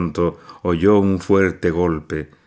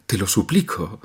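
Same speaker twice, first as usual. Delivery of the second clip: fading plea. A narrator's reading. Nadia repeating her plea to her mother, spent. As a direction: let the repeat die in tears. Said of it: "profirió entre sollozos".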